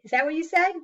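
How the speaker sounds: usual speaking voice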